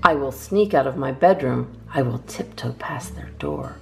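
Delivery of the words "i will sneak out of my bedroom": usual speaking voice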